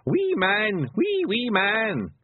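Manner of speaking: put-on high, drawn-out small-child voice; delighted toddler singsong; chuckling toddler. A narrator's reading. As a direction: coo like an amused little boy.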